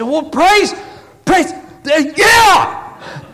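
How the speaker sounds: high, loud, stumbling praise burst; overjoyed, tongue-tied shout. Retold or imitated. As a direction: act out someone too happy to find words. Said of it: "didn't know what to say".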